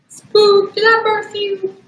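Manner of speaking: very high squeaky pet voice; baby talk to the dog. Pretend. Owner cooing at her puppy in a squeaky high voice.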